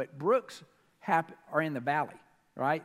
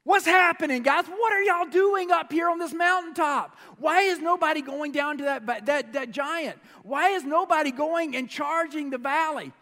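loud, high challenge; boy David yelling at his brothers; David calling out; 8 semitones higher, 6 dB louder than usual. Retold or imitated. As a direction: shout up at the brothers on the mountain. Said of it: "And he's like".

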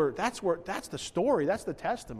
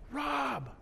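high, drawn-out call of a name; imitated yell; 10 semitones higher than usual. Some guy calling across a service plaza, imitated yell. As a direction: mimic someone hailing a friend from afar. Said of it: "I hear some guy yell".

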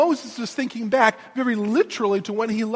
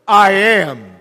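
much louder, booming; voice of God; 2 semitones lower, 10 dB louder than usual. Acted. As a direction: proclaim it like God.

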